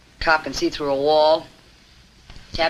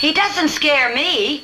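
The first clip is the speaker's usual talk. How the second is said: defiant; 11 semitones higher, much slower than usual. Acted. Defiant man taunting the caller.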